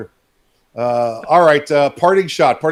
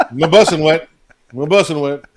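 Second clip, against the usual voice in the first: louder playful My bussin' wet; mock repeat; 6 dB louder than usual. Co-host loudly repeating the Bidenese phrase. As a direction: toss it out for a laugh. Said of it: "repeats phrase amused".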